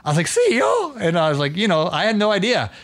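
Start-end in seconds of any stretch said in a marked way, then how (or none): 0.3-0.9 shocked high squeak; surprised. Retold or imitated. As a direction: blurt it out in disbelief. Surprised at learning CEO.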